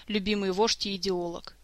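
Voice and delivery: usual speaking voice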